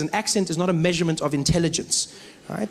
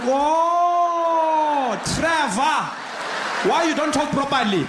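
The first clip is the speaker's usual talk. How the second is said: put-on high, drawn-out accented exclamation; sudden realization; 9 semitones higher, much slower than usual. Man finally getting Trevor's name, high accented exclamation.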